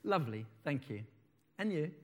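low, soft courteous answer; polite, expected reply. A narrator's reading. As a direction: read it as understated, dutiful politeness. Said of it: "I politely say what's expected of me".

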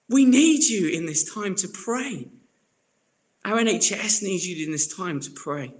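rally the furloughed friend to pray; urgent encouragement. Retold or imitated. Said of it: "And I was like".

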